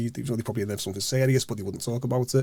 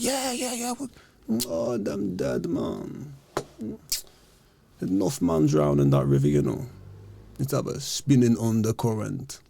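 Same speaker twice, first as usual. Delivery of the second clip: put-on old geezer; 3 semitones higher than usual. Old man tells the lads their friends are dead, flat put-on voice. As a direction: shrug it off like a weary local who has seen it all. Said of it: "these old geezers were like".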